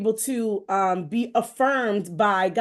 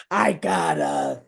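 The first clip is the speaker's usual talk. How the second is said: laughing; much slower than usual. Real, not acted. Acting out, laughing.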